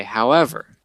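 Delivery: usual speaking voice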